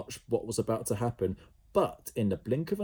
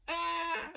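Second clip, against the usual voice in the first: very high yell; 4 dB quieter than usual. A narrator's reading. Jemima booted down the corridor, high yell. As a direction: yelp while bouncing away. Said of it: "she yelled".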